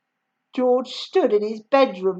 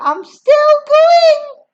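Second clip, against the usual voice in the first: high, loud, slow crone call; Grandma calling from the attic; scratchy old voice; 11 dB louder, much slower than usual. Acted. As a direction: croak it down from above. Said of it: "came the old scratchy voice".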